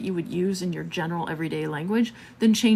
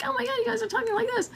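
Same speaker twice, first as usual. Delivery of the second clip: very high and fast, chipmunk-like; squeaky sped-up Mickey Mouse voice; sped-up video voice; 4 dB quieter, much faster than usual. Retold or imitated. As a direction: mimic a video played too fast. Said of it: "when we speed up the video to be like".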